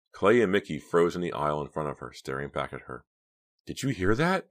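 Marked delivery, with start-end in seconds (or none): 3.7-4.5 high-pitched startled question; worried outcry; alarmed cry. A narrator's reading. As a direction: ask in alarm, pitch climbing. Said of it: "Pam cried".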